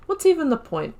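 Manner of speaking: ordinary talk, nothing marked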